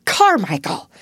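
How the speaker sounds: "Carmichael!" cried out loud; loud cry. A narrator's reading. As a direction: call the name out urgently. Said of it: "cried out loudly".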